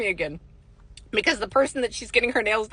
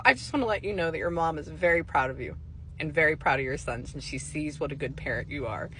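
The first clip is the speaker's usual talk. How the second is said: lower, softer, tender retelling; gentle reassurance; 6 semitones lower, 6 dB quieter than usual. Retold or imitated. Psychic passing on a mother's pride, gently reassuring. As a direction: let it settle down softly and sincerely.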